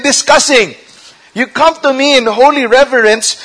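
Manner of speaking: high-pitched, emphatic rebuke as God; indignant confrontation. Acted. God confronting two-faced worshipers.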